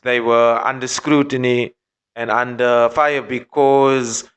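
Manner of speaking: usual speaking voice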